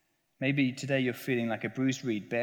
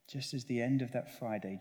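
ordinary talk

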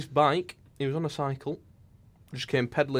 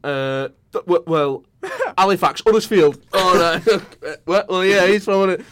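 flustered stammer; 6 semitones higher, 6 dB louder than usual. Retold or imitated. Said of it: "And I said".

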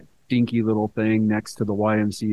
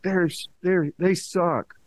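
higher-pitched, unqualified "they suck"; blunt verdict; 7 semitones higher, slower than usual. Shown as a demonstration. Podcaster showing how the opinion comes out, blunt. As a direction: flatly dismiss the band, pitched up. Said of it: "I mean more like".

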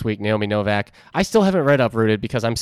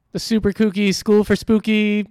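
high, bouncy, silly singsong; playful high voice; 8 semitones higher than usual. Pretend. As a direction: sell the goofy name, pitched up. Mock spooky school name.

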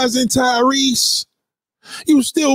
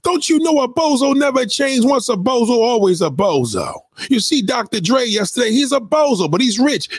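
ordinary talk

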